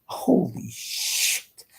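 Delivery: drawn-out, half-voiced exclamation; retold shock. Vlogger recalling cockroaches scurrying at night, retold shock. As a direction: stretch it out in hushed disbelief. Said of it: "and I go".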